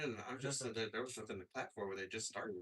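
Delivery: normal talking voice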